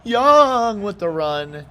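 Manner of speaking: excited play-by-play cry; excited drawn-out call. Real, not acted. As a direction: sing out the runner's name. Calling the big run.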